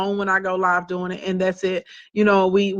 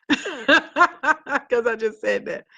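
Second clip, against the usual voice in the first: laughing; 4 semitones higher, much slower than usual. Real, not acted. Laughing at her own jab.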